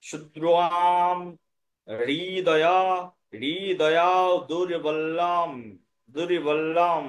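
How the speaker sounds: slow, held Sanskrit endings; original pronunciation. Shown as a demonstration. Teacher modelling the verse's next line, slow drawn-out pronunciation. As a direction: stretch শুদ্রাম and দুর্বল্লাম, then stop. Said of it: "এবার দুর্বল্লামে থামতে হবে".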